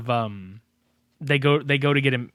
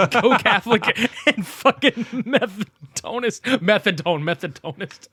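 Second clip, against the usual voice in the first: giggling through the tangled words; laughing at his own mix-up; laughing stumble; 7 semitones higher, 4 dB louder than usual. Real, not acted. Cracking up while fumbling the word. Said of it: "What the fuck is wrong with me?".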